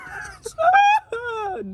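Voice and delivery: high cry of pain breaking into a laugh; pained laughing scream. Real, not acted. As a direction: scream and laugh through the hurt. Reacting to the burn.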